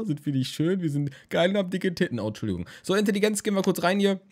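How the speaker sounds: ordinary talk, nothing marked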